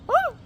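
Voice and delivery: imitated sudden female moan; short, mock cry of pleasure.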